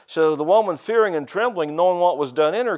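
ordinary delivery

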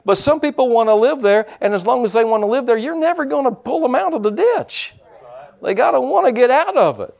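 revved-up sermon voice driving the point home; fiery preaching. Acted. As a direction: preach it hard to the congregation. Preaching at full force.

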